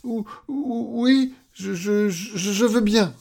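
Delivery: high, trembly stuttered consent; fearful stammer. Acted. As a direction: stutter your way to a timid yes. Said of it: "balbutia-t-il". (balbutiement aigu et craintif)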